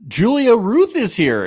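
loud, high welcome of a guest; excited announcement. Real, not acted. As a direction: announce her name with a burst of glee.

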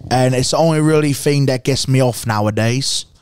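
British accent drifting towards Australian; mock British, roughly imitated; put-on British accent. Shown as a demonstration. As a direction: do an amateur Brit. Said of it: "British or is that Australian?".

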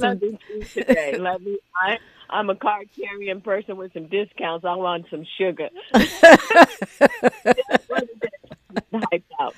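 giggling through the words; laughing. Real, not acted. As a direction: laugh while begging for sweets. Laughing joke about wanting sugar.

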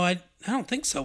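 ordinary delivery

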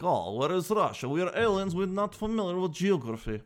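put-on puzzled alien with a Russian accent. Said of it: "you sound like Russia".

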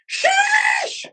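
very high drawn-out shouted sheesh; mock viewer shout. Mocking viewers who yell the term, very high shout. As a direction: squeal it like a hyped chat. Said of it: "every one of you motherfuckers go".

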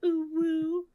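put-on high squeaky anime girl voice; VTuber girl voice. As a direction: coo it like a cutesy streamer. Keeps up VTuber voice.

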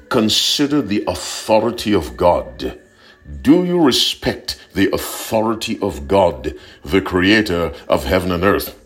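ordinary delivery

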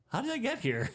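high, baffled question; lost and confused; bewildered. Retold or imitated. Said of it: "And I'm like".

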